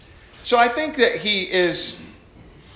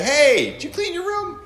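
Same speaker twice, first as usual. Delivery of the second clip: high, cheery hey calling out; a bright-voiced parent calling upstairs; sing-song call; 11 semitones higher than usual. Shown as a demonstration. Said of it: "Or you can say".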